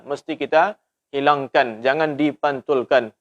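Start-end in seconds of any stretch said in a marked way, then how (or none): none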